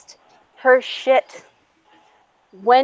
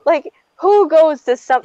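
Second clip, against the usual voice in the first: high-pitched outraged questions; heated indignant rant; retold screaming; 6 semitones higher than usual. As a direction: demand it in disbelief.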